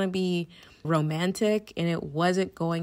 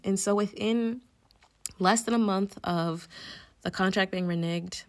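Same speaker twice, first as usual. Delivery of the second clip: ordinary talk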